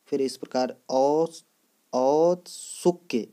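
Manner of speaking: औत्सुक्य sounded out slowly, syllable by syllable; deliberate औत्सुक्य; slow spelling demo. Shown as a demonstration. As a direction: draw out औत्सुक्य so students catch each sound. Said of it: "इस प्रकार लिखा जाता है".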